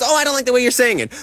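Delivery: high, quick mock complaint; mocking a critic. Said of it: "All you can go is".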